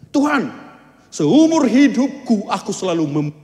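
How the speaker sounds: high, loud protest to God; complaining shout. Acted. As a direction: yell your grievance at God. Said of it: "Dia teriak sama Tuhan". (orang saleh berteriak memprotes Tuhan)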